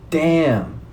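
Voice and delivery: high, surprised damn; a squeaked, stunned damn. Retold or imitated. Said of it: "I was just like".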